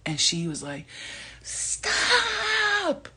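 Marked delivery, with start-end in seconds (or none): 1.4-3.1 high, held imitation of a girl's cry; screaming young girl. Young girl telling them to stop bothering, imitated scream. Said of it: "she sounded like a young girl".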